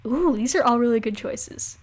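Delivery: ordinary talk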